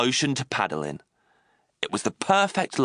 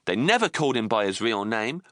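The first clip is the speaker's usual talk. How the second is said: usual speaking voice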